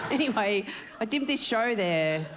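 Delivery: normal talking voice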